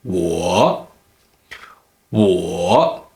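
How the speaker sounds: 我 dipping low, then rising; third tone dipping. Shown as a demonstration. Teacher pointing out the dip, 我 falling low then rising. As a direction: sink down on 我 and come back up. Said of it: "notice the dipping here".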